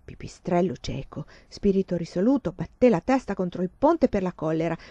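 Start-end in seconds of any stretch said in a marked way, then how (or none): none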